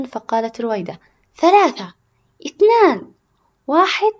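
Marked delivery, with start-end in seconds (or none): 1.4-4.1 high, loud race start call; shouted countdown. Acted. Ruwaida starts the bike race, shouting the countdown.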